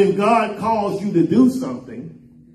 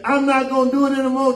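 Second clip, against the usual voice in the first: quick, higher-pitched mock refusal; imitated child's protest; 6 semitones higher, faster than usual. Child talking back to a scolding parent, imitated protest. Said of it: "then they make a song out of it".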